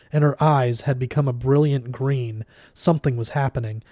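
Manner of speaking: ordinary delivery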